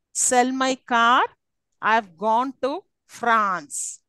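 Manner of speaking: slow, with "Sell", "car", "gone" and "France" drawn out; stressed content words. Shown as a demonstration. Teacher completes the telegram message, stressing "Sell", "car", "gone", "France". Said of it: "Now, these are stressed".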